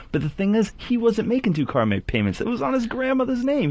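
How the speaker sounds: normal talking voice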